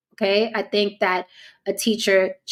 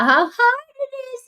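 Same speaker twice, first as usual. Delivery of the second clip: high-pitched fake weepy whine; hammed-up tearful complaint; mock crying. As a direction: slide up into a mocking wail. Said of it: "get to crying about".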